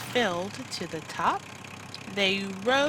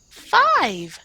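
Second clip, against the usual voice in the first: high loud eager answer; 9 semitones higher, 8 dB louder than usual. Acted. Minna answering the farmer's question, eager and loud. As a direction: blurt it out proudly. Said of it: "said Minna".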